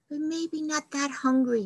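ordinary delivery

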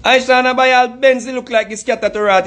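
ordinary talk, nothing marked

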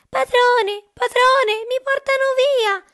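very high, loud shriek for help; crying out loudly. A narrator's reading. Stolen harp calls its sleeping master, high loud cry. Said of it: "iniziò a gridare ad alta voce".

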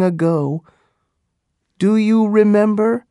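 impassioned Pocahontas. Acted. Pocahontas recalls her love, upset.

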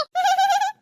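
put-on short high girlish giggle. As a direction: laugh at the joke, lightly. Girls joking about rocket brain. (ചെറുതായി ചിരിക്കുന്ന കുട്ടി)

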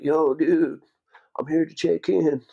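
high, loud, slow dude voice; laid-back surfer dude. Retold or imitated. SEAL checking in at the armory door, high and loud surfer-dude drawl. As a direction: play the skinny blonde SEAL all chill and casual.

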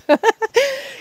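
high, bubbling real laugh; a burst of surprised giggling. Real, not acted. Host laughs as chickens interrupt her video, high giggle.

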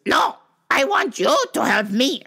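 high, mocking demanding-shopper voice; insistent customer. Impersonates customer refusing the teller.

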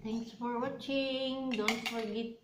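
speaking between spoonfuls; slightly cold mouth from ice cream; talking while eating. Real, not acted. Seen 3: spoon of purple ice cream lifted while talking, ate just before.

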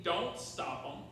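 normal talking voice

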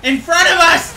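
loud, high urgent yell; warning shout. Real, not acted. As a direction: shout the danger to the team. Warns team of attack.

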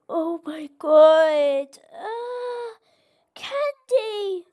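high, slow, gushing squeal; delighted squeal. Real, not acted. Gamer reaches Candyland, delighted squeal.

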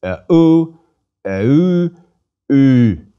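E-u fused into one syllable; vowel demo. Shown as a demonstration. Teacher builds the fused vowel slowly. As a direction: glide E-u, e-u to u. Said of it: "eh plus u fused".

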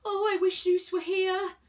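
high, loud wail; loud high-pitched cry; screamed wish. A narrator's reading. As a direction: wail it out in desperation. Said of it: "Virgo screamed again".

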